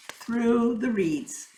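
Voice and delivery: ordinary delivery, nothing marked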